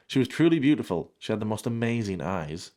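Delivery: ordinary talk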